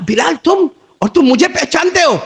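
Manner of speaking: high, loud disbelief; shocked that he is known; astonished. Acted. The traveller asks Bilal in high, loud astonishment.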